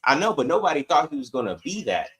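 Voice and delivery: ordinary talk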